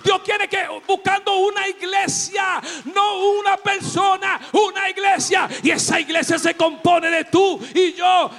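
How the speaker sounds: loud, high, fervent sermon; full-force preaching. Real, not acted. Preacher shouting to his church, full force. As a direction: preach it out to the congregation.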